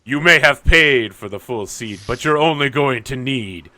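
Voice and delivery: movie announcer. Acted. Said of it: "the announcer, of course, just said".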